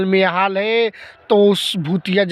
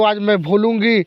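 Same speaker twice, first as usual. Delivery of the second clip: ordinary talk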